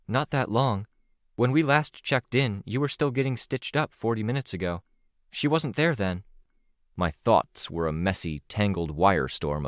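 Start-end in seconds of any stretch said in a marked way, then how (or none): 0.1-6.2 a hint of a higher, younger girl's voice; lightly voiced young girl. A narrator's reading. Vista reassuring Victoria about the time, lightly voiced young girl. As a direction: voice the teen heroine a little higher.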